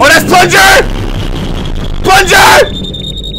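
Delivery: high, excited shout of a name; excited recognition. Real, not acted. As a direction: yell it as a favorite shows up. Recognizing a character on screen.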